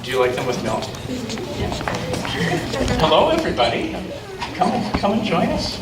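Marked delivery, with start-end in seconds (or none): none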